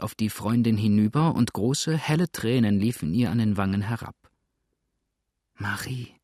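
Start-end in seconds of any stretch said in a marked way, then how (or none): 5.6-6.1 barely audible. A narrator's reading. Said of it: "Leise, fast unhörbar".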